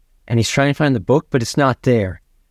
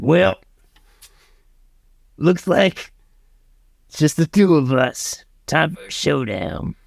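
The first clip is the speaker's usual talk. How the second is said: cowboy; 3 semitones higher than usual. Acted. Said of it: "he sees the cowboy, and he's like".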